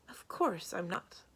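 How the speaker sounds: slow, breathy whispered impatience; brushing off a silly question; impatient. A narrator's reading. Samarine waves off his worry, impatient whisper. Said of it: "replied impatiently".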